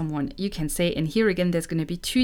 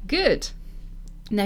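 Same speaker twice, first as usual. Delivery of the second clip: ordinary talk, nothing marked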